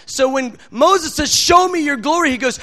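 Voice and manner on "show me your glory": eager plea. Retold or imitated. Moses pleading with God.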